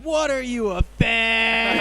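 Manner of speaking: high, loud jeering taunt; mock taunting yell; mocking buddies. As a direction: heckle him like a jeering buddy. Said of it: "his buddies in the back of his head".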